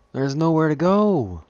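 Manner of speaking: high, slow, trapped wail; desperate cry. Real, not acted. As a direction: cry out cornered. Trapped while chased.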